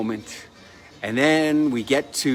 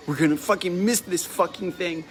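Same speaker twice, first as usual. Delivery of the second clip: imitating panicky wife, pitched higher; mock-panicked complaining, higher up; nervous wife; 9 semitones higher than usual. Wife fretting about missing the concert, mock-panicked and higher. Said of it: "Allie's getting nervous".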